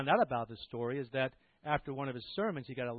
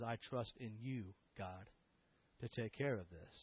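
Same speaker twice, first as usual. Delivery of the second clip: hushed prayer; 4 semitones lower, 9 dB quieter than usual. Retold or imitated. Girl's whispered prayer to God.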